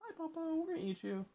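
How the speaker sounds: tiny high, hushed doggy voice; very soft, quick and squeaky, as the dog; cute little pet talking to papa; voiced pet dog.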